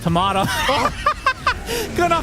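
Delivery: fast, rising hype commentary; play-by-play. Acted. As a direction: call the teamfight as it happens. Live esports play-by-play.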